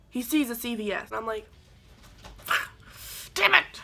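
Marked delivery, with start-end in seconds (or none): 3.3-3.8 high, loud exasperated curse; mock dismay. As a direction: groan it out, annoyed. Dismay at seeing the store.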